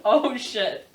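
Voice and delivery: ordinary talk, nothing marked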